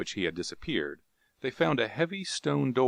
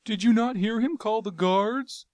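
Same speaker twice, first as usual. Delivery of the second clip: high, hopeless lament; despairing moan; 6 dB louder than usual. Acted. Jav moaning in despair to Carthoris. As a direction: moan it without hope. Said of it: "he moaned".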